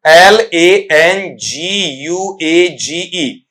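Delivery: slow letter-by-letter spelling. Shown as a demonstration. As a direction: spell it out slowly, each letter separate. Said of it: "इसका spelling भी तुम्हें पता होना चाहिए".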